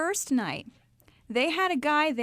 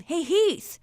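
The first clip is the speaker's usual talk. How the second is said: loud, high call-out to a friend; imitated yell; 7 semitones higher, 6 dB louder than usual. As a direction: call him over, raised voice. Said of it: "leaned over his shoulder and yelled".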